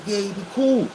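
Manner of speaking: high-pitched, put-on innocence; mock-innocent reassurance. Friend vouching for a man to his accuser, mock-innocent.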